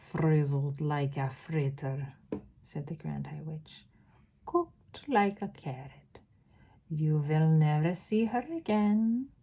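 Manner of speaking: put-on slow, gloating witch accent; Grand High Witch accent. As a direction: savour each word in a light witch accent. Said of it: "do the accent".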